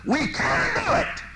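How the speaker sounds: loud, fervent preaching; preaching at full force. Real, not acted. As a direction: drive it home to the congregation.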